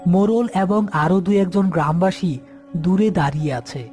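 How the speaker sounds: plain reading voice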